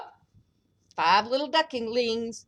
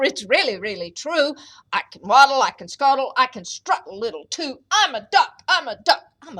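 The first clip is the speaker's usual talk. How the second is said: louder, pleading fake duck; insistent stranger; 8 dB louder, faster than usual. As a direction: protest your duckness, louder. Stranger protests he's a duck.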